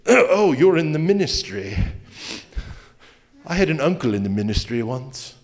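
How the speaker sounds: a stranger turning suddenly British for the pastor; mock posh British accent. Person meeting a pastor, slipping into a mild mock British accent. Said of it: "Where did you get the British accent?".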